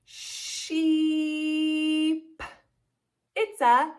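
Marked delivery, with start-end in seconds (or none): 0.1-2.4 SHEEP drawn out very slowly; stretched word. Shown as a demonstration. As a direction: hold the EE in SHEEP on a level note. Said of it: "Let's say sheep".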